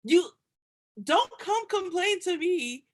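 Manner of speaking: high, slow exasperated scold; mock scolding. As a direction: draw out each word to shut the complaining down. Scolding an imagined complainer.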